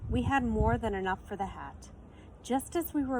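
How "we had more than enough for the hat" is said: usual speaking voice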